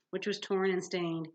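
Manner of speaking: usual speaking voice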